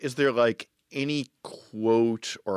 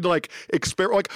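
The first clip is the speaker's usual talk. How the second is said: usual speaking voice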